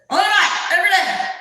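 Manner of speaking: high mimicked shout; barking loud boss. Boss insisting on daily miles, shouting. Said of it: "he's loud".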